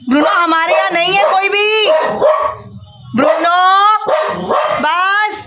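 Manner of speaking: high, loud calls to stop the dog; calling the dog sharply. Real, not acted. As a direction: call him off firmly. Scolding the barking dog.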